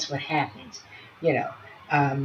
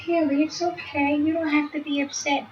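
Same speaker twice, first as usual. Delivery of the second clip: reassuring; 8 semitones higher than usual. Shown as a demonstration. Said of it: "you can say".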